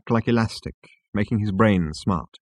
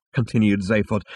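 ordinary delivery, nothing marked

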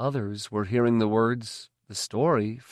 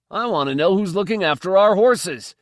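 insistent shout; 8 semitones higher, 7 dB louder than usual. A narrator's reading. Stable hand insisting.

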